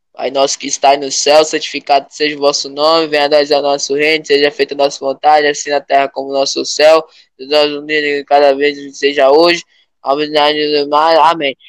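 low, steady prayer recital; prayer recitation. Shown as a demonstration. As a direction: recite the Lord's Prayer reverently. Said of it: "ó como eu rezo".

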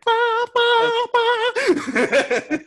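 high sung ba ba ba like a flicked needle; ping out three high little notes; musical note imitation. Mimicking the doctor's needle flick as a high musical note. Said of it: "Makes a musical ass note".

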